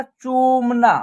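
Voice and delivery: चूमना slowly joined, with a long ū and long final ā; blended pronunciation. Shown as a demonstration. Teacher showing how चूमना is said all together, drawn out. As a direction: sound out चूमना as one drawn-out word. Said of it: "तो एक साथ उच्चारण होगा इसका".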